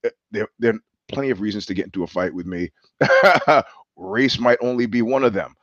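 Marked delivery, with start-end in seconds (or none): 3.0-3.6 short, higher-pitched chuckle; amused laugh. Real, not acted. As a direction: burst of loud laughter at own remark. Laughs at his own remark.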